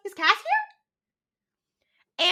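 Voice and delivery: high hopeful ask; eager question. Retold or imitated. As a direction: perk up hopefully.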